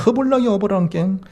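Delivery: high, singsong Jeolla dialect; hammed-up Jeolla accent, pitched high. Shown as a demonstration. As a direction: play up the Jeolla ending. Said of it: "전라도".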